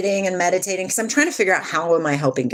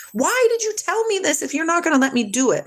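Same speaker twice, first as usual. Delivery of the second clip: high-pitched, aggrieved complaint; retold mad protest; 11 semitones higher than usual. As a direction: demand an answer from God, pitch lifted high. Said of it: "you know how you get mad".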